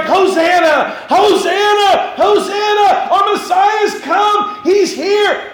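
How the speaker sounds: very high, loud, slow joyful cries; children shouting hosanna. Pretend. Preacher plays children hailing the Messiah, shouting high. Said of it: "And they're like".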